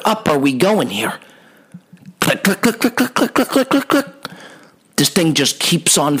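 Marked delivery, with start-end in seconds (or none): 2.2-4.1 imitated lift clicking.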